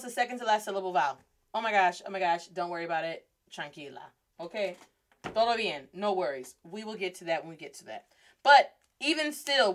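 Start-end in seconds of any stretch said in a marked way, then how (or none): 1.5-8.1 low, soft calming voice; gentle, hushed reassurance; reassuring. Acted. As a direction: soothe a panicked student, letting it drop softer. Teacher soothing the panicked student.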